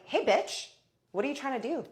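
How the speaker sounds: heated, high-pitched challenge; coming in hot. Retold or imitated. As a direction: imitate a dancer picking a fight. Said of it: "Coming in real hot".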